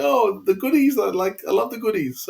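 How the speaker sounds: eager fan remembering a loved comedy show, excited; excited fan thoughts. Retold or imitated. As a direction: squeal inwardly about a favourite show. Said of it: "and I was thinking".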